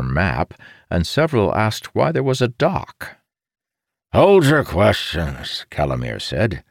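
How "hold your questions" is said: slow, firm call for order; a hint of a commander taking control. A narrator's reading. Commander calming the room.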